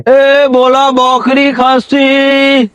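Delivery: high, slow, drawn-out street vendor cry; hawker's call. Acted. Goat buyer hawking door to door, long drawn-out sing-song call. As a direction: stretch every word like a village goat buyer calling out.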